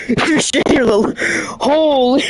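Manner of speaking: breaking into laughter mid-line; losing it while trying to repeat; laughing. Real, not acted. Friend jokes, cracking up laughing.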